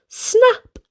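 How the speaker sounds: ordinary delivery, nothing marked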